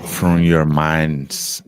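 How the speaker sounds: ordinary talk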